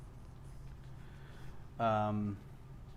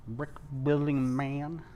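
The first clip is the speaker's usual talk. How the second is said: slow, drawn-out teasing question; playful slow repeat; 3 semitones higher, slower than usual. Pretend. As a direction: stretch it out lazily, teasing.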